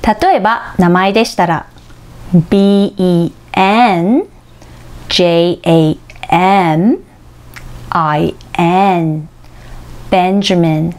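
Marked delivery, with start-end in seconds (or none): 2.5-9.3 ビーイーエヌ, ジェーエーエム, アイエヌ said slowly with the last letter of each group stressed; 三文字ごとに最後を強く; spelling in groups of three. Shown as a demonstration. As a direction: spell BEN JAM IN, hitting ヌ, ム and ヌ. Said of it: "最後のアルファベットを強く発音します".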